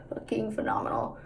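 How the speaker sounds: breathy whispered aside. Real, not acted. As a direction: whisper it like a secret. Whispered emphasis.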